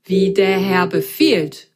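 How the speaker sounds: slow, cheerful machine voice; cheerful tinny voice. Acted. The washing machine answers Grasgrün, slow and tinny. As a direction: answer like a merry tin robot. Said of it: "ertönte eine fröhliche, blecherne Stimme".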